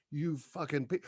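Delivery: usual speaking voice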